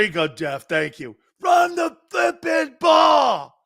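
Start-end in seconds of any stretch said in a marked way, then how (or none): 1.4-3.5 high, loud, slow yell of flipping ball; mock fan yell. Imitating the angry fan screaming for the run. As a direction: drag out the yell like a furious fan. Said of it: "she was just in the stands screaming".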